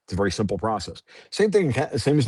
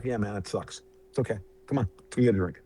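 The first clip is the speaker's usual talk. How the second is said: forgiving friend; 2 semitones lower, 5 dB quieter, faster than usual. Retold or imitated. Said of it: "I'll be like".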